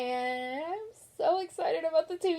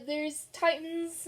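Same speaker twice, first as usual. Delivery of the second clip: ordinary talk, nothing marked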